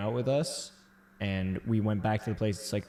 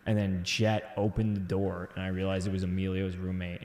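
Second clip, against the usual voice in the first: usual speaking voice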